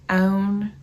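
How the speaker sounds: "own" in a loud Southern drawl for "on"; grandma's Southern accent. Retold or imitated. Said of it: "whipping out my grandma's accent".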